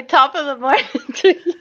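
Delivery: lilting line broken by laughter; laughing demo. Shown as a demonstration. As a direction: giggle through the greeting. Feeds accent line, breaks up laughing.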